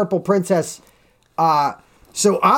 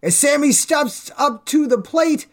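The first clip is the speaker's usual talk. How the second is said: higher, slow announcer-like verse reading; reading a poem; 6 semitones higher, slower than usual. Acted. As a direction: read it like a ballpark rhyme, slowly. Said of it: "reading the bear's poem aloud".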